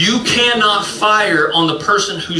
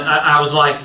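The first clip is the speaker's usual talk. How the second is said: usual speaking voice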